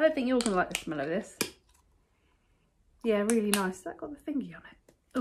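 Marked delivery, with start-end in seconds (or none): 3.8-5.2 breathy unvoiced aside; whispering. Real, not acted. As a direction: whisper it to yourself.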